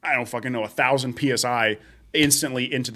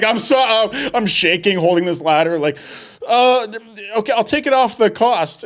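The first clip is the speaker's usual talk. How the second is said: high, louder panicky voice; flustered; 8 semitones higher, 5 dB louder than usual. Retold or imitated. Worker after breaking vase, high flustered voice. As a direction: stammer it out in a fluster. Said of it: "I'm like".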